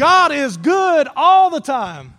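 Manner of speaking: preacher fires up the congregation for the response; enthusiastic shout. Shown as a demonstration. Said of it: "say it enthusiastically".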